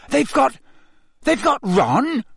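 high, breathless stammer; shocked gasp. Acted. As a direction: gasp it in horror. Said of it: "Harry gasped".

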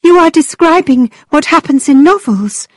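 put-on eager girlish voice, high; bright high young woman; young heroine. A narrator's reading.

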